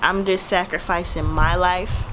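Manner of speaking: ordinary delivery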